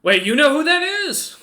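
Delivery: high, loud startled question; surprised ogre. Acted. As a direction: blurt it out, caught off guard.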